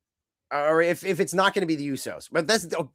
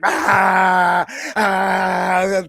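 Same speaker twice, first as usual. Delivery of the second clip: louder, wild ragged yelling; crazed mock yells; 4 semitones higher, 7 dB louder than usual. Jacob going insane down the hallway, yelling wildly. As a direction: yell like a man gone mad on power. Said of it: "He's like".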